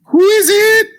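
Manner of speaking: loud high call, who is it; high shout through door. Acted. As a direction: yell it through the closed door.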